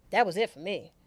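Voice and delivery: normal talking voice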